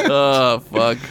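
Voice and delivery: usual speaking voice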